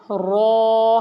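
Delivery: رو said thick and full, lips rounded; heavy rounded رو. Shown as a demonstration. Teacher showing the heavy letter رو to children. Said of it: "رو کو ہم نے موٹا کر کے پڑھنا ہے".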